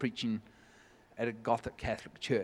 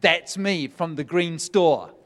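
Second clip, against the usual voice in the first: loud and slow, each word weighted; woman standing to claim her story, loud slow announcement; emotional announcement; 4 semitones higher, 10 dB louder, slower than usual. Retold or imitated. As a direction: declare it slowly and loudly to the whole room. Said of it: "the woman stood up and goes".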